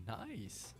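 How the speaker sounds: usual speaking voice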